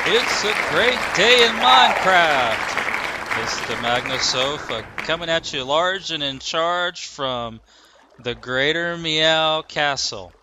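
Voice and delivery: loud, slow, big showman intro; hyped announcer. Acted. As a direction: boom it out like a show host. Announcer intro over feedback.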